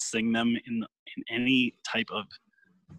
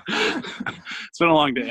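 high laugh, then slow weary line; 5 semitones higher, much slower than usual. Real, not acted. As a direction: laugh it off, tired. Laughing at own slip.

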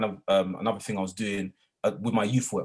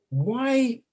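high, stunned why; disbelieving why; 13 semitones higher, 4 dB louder than usual. Retold or imitated. Teaching assistant reacting to the accusation, stunned and high.

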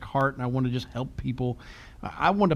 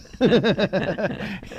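laughing; 3 semitones higher than usual. Real, not acted. Laughing at his own yelling.

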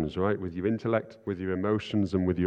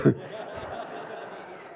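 breathy laugh; 4 semitones higher, 5 dB quieter than usual. Real, not acted. Laughing at own story.

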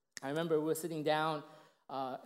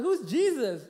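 put-on raised, louder puzzled-kid voice; mock bafflement; 7 semitones higher, 9 dB louder, slower than usual. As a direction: ask it like a clueless schoolboy. Child baffled by teacher's answer, retold.

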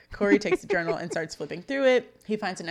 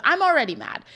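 retold anger; 8 semitones higher, 7 dB louder than usual. Feeney scolds the boys.

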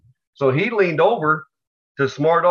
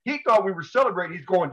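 ordinary talk, nothing marked

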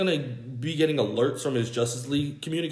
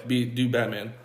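normal talking voice

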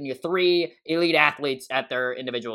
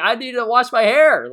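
exasperated; 9 semitones higher, 8 dB louder than usual. Retold or imitated. Retold own complaint, loud.

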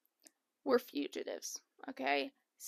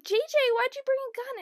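alarmed question; 12 semitones higher, 3 dB louder than usual. Retold or imitated. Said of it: "They're like".

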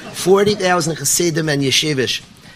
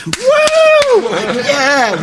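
a sudden, much higher, louder whoop; wild cheer; 5 dB louder than usual. Retold or imitated. Fan at the ballpark whooping for a home run, wild cheer. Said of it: "the ten thousand of us would go wild".

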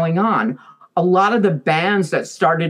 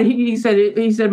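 ordinary delivery

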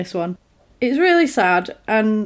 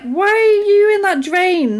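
high, loud, drawn-out mock disbelief; mock incredulous; 12 semitones higher, 6 dB louder than usual. Talking back to a clown in a drain, mock incredulous. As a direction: question it high and slow, hammed-up.

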